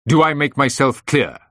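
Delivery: loud, high, emphatic challenge; angry ultimatum. A narrator's reading. Boss demanding that employee obey, angry and loud. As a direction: press the point forcefully. Boss angrily threatens on the phone.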